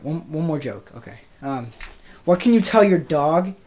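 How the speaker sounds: normal talking voice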